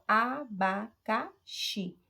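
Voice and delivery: "Abacaxi" very slowly in four beats, a-ba-ca-xi; teacher's slow four-pulse "Abacaxi"; syllable by syllable. Shown as a demonstration. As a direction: pause between every syllable of "Abacaxi". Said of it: "você vai ter quatro emissões de voz".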